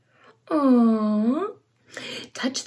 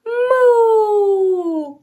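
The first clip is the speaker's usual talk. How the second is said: high, louder playful moo; mooing like a cow; 8 semitones higher, 4 dB louder than usual. Acted. Asking the child to copy the cow's moo. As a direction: show the child how the cow moos. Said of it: "Can you moo like that cow?".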